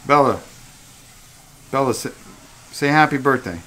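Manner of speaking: loud coaxing call to a dog. Real, not acted. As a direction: call her name up and loud. Calling the dog to speak.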